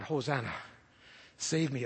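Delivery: soft, low prayer under the breath; a hushed plea for rescue; quiet plea. Pretend. Jesus praying quietly at the burial, soft low plea. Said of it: "you have to say".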